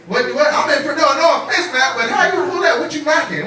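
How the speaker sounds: high-pitched flustered questioning; mock amazement. Comic quizzing Mac about his girl, incredulous.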